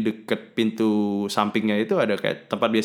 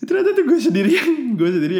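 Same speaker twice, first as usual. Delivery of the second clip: high, loud, laughing through the words; laughing punchline; 13 semitones higher, 5 dB louder than usual. Real, not acted. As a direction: crack up at yourself while saying it.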